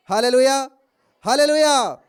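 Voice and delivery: loud, ringing hallelujah; hallelujah shout. Real, not acted. Preacher shouting hallelujah to the congregation. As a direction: call it out twice to the church.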